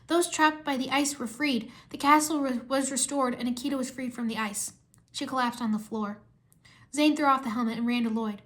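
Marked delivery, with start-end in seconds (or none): none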